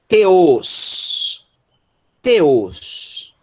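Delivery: "Teos" drawn out with a hard T, no TH; stretched, deliberate "Teos" with a simple T; plain dental T. Shown as a demonstration. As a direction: model "Teos" slowly in two takes, T on the teeth. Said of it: "vou pronunciar como T".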